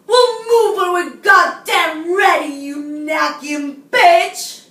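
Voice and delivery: slow, very loud hostile outburst; angry shout. Acted. Shopper refusing to move for the woman, furious shouting. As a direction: bellow it, drawing out each insult.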